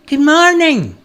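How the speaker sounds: high, loud hail; calling out. A narrator's reading. Stranger calling out to the woodcutter, calling out. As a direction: call out a greeting from a way off. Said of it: "he called out to Peter".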